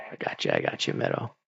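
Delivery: softly whispered reassurance. Real, not acted. Whispering to friend Meadow, I got you. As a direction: breathe it under your breath.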